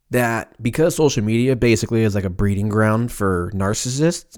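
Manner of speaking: normal talking voice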